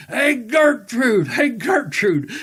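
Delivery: loud, high drawn-out holler for someone; grandfather yelling. Retold or imitated. Grandfather hollers for grandmother from bed, drawn-out yell. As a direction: imitate a drunk bellowing for his wife. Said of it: "he'd yell out my grandmother".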